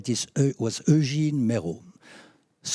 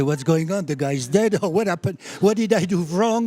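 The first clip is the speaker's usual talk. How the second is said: high, loud panicked questions; frightened young doctor on the phone; 5 semitones higher, 6 dB louder than usual. Retold or imitated. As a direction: blurt it out in alarm, pitch climbing. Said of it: "I got really scared".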